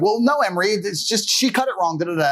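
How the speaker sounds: high mock protest of the mother; mom protesting. Mother defending the haircut to dad, high and pleading. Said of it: "my mom was like".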